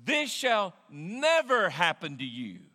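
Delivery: slow, loud, never stretched out; slow emphatic vow; stress on never. Retold or imitated. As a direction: lean hard on never. Peter's rebuke continued.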